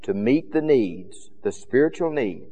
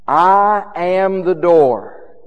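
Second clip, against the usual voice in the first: loud, very slow, raised proclamation; Jesus's claims; 4 semitones higher, 6 dB louder, much slower than usual. Acted. Preacher quoting Jesus's claims to the congregation, loud slow proclamation. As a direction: stretch the opening words and ring them out. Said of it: "let me give you some of the claims".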